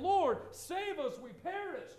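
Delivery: high, loud cry of panic; panicked plea. Acted. Disciples wake Jesus in the storm, panicked cry. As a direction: cry out like sailors about to drown.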